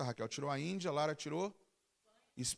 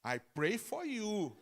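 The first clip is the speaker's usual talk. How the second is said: put-on slow, laughing Brazilian-accented English; hammed-up Brazilian English, drawn out; self-mocking Brazilian English with a laugh; broken English; 6 semitones higher, much slower than usual. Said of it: "meu inglês é aquele assim".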